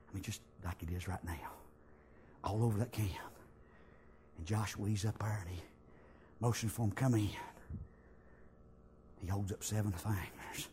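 hushed storytelling. Acted. Preacher describing the silent camp, hushed. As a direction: draw the congregation in with a hush.

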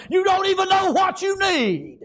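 high, shouted revival cadence; fired-up preacher in full cry; full-force preaching. Real, not acted. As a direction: shout it out in driving, rhythmic phrases.

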